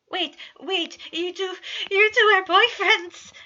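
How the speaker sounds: high, loud, thrilled outburst; excited exclamation. A narrator's reading. Patton realizes his friends are dating, high and thrilled. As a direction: burst out with the discovery. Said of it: "Patton exclaimed, vibrating with excitement".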